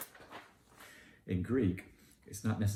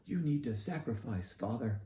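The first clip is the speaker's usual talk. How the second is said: high, mock-insistent demand in a lightly voiced parishioner; mocking pushy parishioner; 4 semitones higher than usual. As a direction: lightly imitate a preachy churchgoer lecturing the priest. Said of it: "love to hurl the clergy to the wolves".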